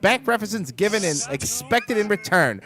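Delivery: put-on loud, high old-time newsreel announcer; 1920s news reporter. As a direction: bark it like a 1920s radio newsman. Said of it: "Do a nineteen twenties news reporter".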